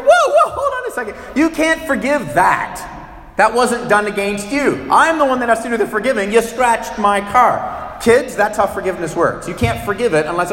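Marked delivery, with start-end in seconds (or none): 0.0-8.0 hammed-up outraged whoa, hold on; indignant car owner. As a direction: protest like the wronged driver. Angry car owner confronting pastor.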